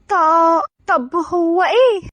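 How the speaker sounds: gulp, then ask timidly; nervous stammer. A narrator's reading. Dareen asking Eyad his condition, nervous stammer. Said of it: "وهي بتبلع ريقها".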